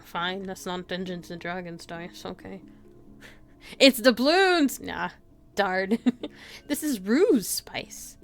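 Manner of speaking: normal talking voice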